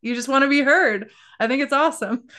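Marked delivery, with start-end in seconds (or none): 1.4-2.3 laughing through the words; real laugh bubbling into the praise. Real, not acted. Host praising guest's accent story, laughing.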